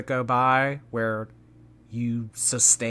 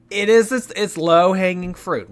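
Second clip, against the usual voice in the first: mock outraged; 8 semitones higher, 12 dB louder than usual. Mock outrage about the joke.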